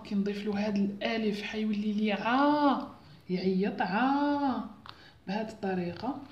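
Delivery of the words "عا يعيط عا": عا drawn out slow, long open a; عا held long and clear for children; long vowel demo. Shown as a demonstration. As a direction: stretch عا like a teacher's model. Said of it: "نجو للحركات الطويلة".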